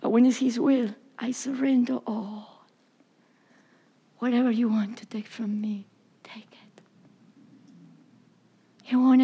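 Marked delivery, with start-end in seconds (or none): 1.2-6.8 soft, breathless surrender; whispered prayer. Acted. Preacher whispering a prayer of surrender to God. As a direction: give it up in a hushed whisper.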